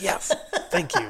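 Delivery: high-pitched, laughing thanks; retold burst of delight, drawn out; gleeful thanks. Rider reacting to a hint about the truck, gleeful.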